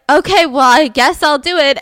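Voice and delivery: grudging agreement. Retold or imitated. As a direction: give in with exaggerated annoyance. Grudging service worker acted.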